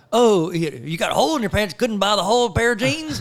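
high, sing-song imitated taunt; mocking jibe. Some dude teasing about holey pants, mocking taunt. Mocking dude's remark retold.

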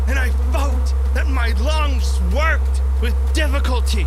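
breathless building alarm in the telling; rising panic. A narrator's reading. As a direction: read it like the dread is catching up with you. Narrator's panic rises as friend vanishes.

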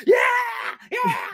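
loud, high-pitched victory yell; triumphant cheer. Retold or imitated. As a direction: cheer it out like a big win. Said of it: "'Cause I'm like".

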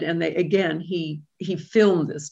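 ordinary talk, nothing marked

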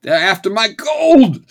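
high, loud cranky coot shout; Crocker, old prospector. Retold or imitated. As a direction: yell like a grizzled old prospector. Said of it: "an old prospector type named Crocker shouts".

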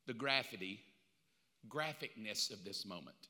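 low, soft, measured reply; quiet patience under attack; calm Jesus. Acted. Jesus answers the accuser, low and calm.

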